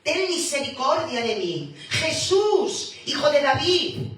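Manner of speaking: loud, high desperate cry for mercy. Acted. Blind beggar shouting to Jesus over the crowd, desperate loud plea. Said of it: "Sigue diciendo".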